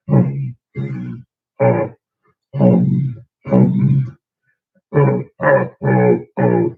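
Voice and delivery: low rumbling ums; bass sounds demo. Shown as a demonstration. Demoing vocalized chest bass, low hums. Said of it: "harmonize correctly".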